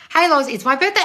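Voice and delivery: ordinary talk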